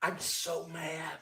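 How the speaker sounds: slow, very soft mock mad; hushed mock anger. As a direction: whisper your fake fury slowly. Hushed mock anger, baby asleep.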